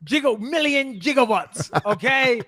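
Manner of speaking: high, strained mock exclamation; hammed-up film-quote voice. Co-host teasing friend about his followers, hammed-up high film-quote voice. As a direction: stretch it out high and over-dramatic.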